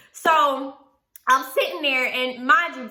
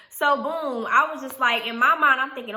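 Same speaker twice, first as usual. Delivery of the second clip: ordinary talk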